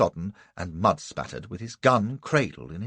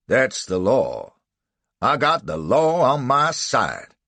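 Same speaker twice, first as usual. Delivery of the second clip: slow, loud, stubborn insistence; defiant; 3 semitones higher, 7 dB louder, slower than usual. Acted. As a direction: dig in your heels. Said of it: "Buck added defiantly".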